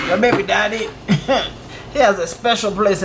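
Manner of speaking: talking through real laughter; laughing. Real, not acted. Reacts laughing to the wild story.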